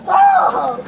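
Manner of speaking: sharp high cry of protest; pained yell. Real, not acted. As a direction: yell it out in pain.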